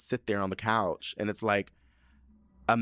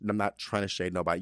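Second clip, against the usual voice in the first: ordinary talk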